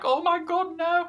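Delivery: hammed-up dismay; mock panic. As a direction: cry out as if watching your son get choked. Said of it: "Rey Mysterio's like".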